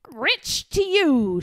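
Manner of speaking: slow, defiant snap back; boy defying the birds; snapped. A narrator's reading. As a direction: throw it back at them. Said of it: "snapped back Eric".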